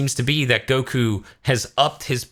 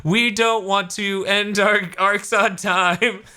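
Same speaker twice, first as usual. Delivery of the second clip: mock outro; 7 semitones higher, slower than usual. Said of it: "just to be like".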